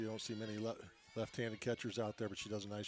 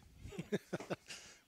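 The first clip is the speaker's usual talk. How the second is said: short, voiceless chuckle; amused laugh at a partner's joke; 8 semitones higher, 3 dB quieter than usual. Real, not acted. Co-commentator amused by his partner's thanks, chuckling.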